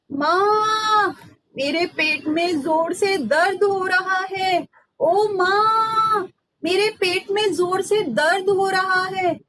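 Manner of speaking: hammed-up whine of a boy faking pain; fake tummy ache. Ram fakes a stomach ache to his mother, hammed-up wail. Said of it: "Ram ने कहा".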